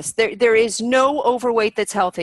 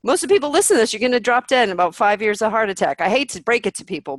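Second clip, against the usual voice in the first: usual speaking voice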